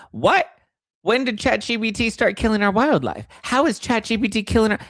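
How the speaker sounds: can't believe what you just read; shocked disbelief. Real, not acted. Outraged disbelief at chat claim.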